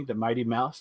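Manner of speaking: usual speaking voice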